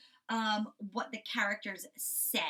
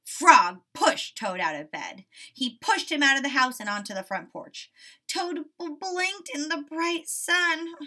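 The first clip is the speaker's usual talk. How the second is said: story narration; 3 semitones higher, 8 dB louder than usual. A narrator's reading.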